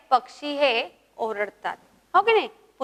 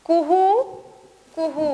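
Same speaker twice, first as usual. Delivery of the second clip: cuckoo call; 2 semitones higher, slower than usual. Shown as a demonstration. Teacher voicing koel for pupils to repeat, rising hoot. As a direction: coo like a koel bird. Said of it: "माझ्या मागं म्हणायचंय".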